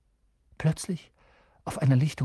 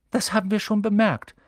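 put-on high, louder girl's voice; schoolgirl character; 10 semitones higher, 5 dB louder than usual. A narrator's reading. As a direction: pipe up as a schoolgirl who already knows. Hermine voiced in the story. (hohe Mädchenstimme, etwas vorlaut)

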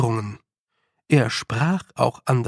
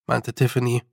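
usual speaking voice

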